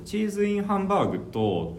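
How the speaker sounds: lightly imitating a Kansai customer's 「チーズインハンバーグと」 with a high rising pitch on チー; 「チーズインハンバーグと」 with Kansai pitch, high start and falling; Kansai accent imitation. Imitating a Kansai customer ordering, in a light Kansai accent. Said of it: "こってこての関西人で".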